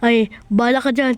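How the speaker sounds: ordinary talk, nothing marked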